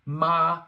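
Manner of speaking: long, loud, high level MA; first tone. Shown as a demonstration. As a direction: sing out MA on one high, flat pitch. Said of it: "so let's review".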